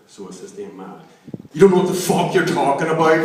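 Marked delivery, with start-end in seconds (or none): 1.5-3.3 1 blunt shout. Retold or imitated. Punchline shouted at evangelist.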